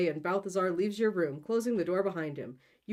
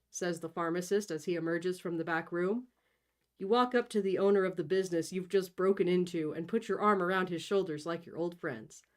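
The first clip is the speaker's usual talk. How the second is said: plain reading voice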